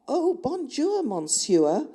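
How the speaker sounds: gushing, high-swooping bad French; hammed-up French greeting with an English accent; worst French accent. A narrator's reading. Narrator heroine greets her pursuer in deliberately bad French accent. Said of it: "in the worst French accent I could muster".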